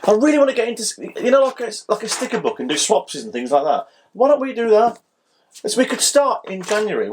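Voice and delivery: high, eager and keen; excited. Real, not acted. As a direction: pitch the idea with real enthusiasm. Eager pitch to start collecting.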